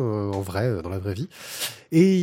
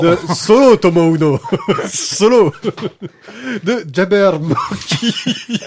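laughing; 4 semitones higher, 5 dB louder, much slower than usual. Real, not acted. Joking mock title reading.

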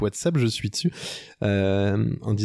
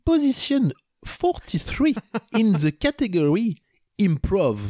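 put-on slow French-accented English with a big high lift on Position; proud slow reading in French-accented English; 4 semitones higher, slower than usual. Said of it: "vous pardonnerez, euh, mon accent".

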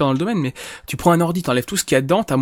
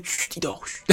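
soft mock hush, much quieter; hushing whisper; 12 dB quieter, slower than usual. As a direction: shush like near a sleeping baby. Mock hushing around fake baby.

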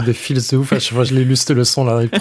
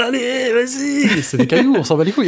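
hyped cheering; 10 semitones higher than usual. Retold or imitated. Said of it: "J'étais en mode".

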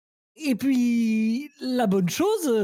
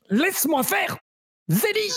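urgent shout; 8 semitones higher than usual. Acted. Forcing her to vomit.

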